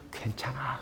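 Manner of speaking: low, breath-only reassurance; hushed, tender whisper; whispered comfort. Acted. The Lord voiced comforting listeners.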